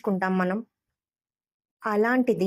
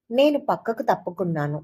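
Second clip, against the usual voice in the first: ordinary delivery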